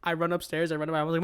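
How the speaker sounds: usual speaking voice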